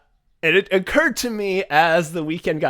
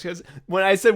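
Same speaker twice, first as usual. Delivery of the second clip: usual speaking voice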